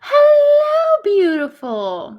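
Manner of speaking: high, loud, drawn-out squeal of welcome; gasp, then stretch out a high hello; gushing greeting. Real, not acted. Greeting Wicked on arrival, gushing greeting.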